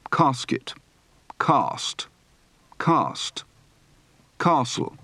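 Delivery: long ah in "ask", "bath", "class"; word list, each A as in father, "castle" as KAH-sl; open-throated ah on "dance"-type words like "chance"; broad open-throated A. Shown as a demonstration. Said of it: "Practice for the broad, open-throated A".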